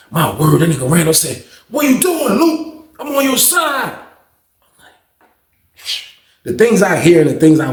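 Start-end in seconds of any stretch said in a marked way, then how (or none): 1.7-3.9 high, hurt-sounding imitation of a plea; mock pleading. Randall appealing to Luke after being shoved, mock pleading. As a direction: protest in a put-on high voice.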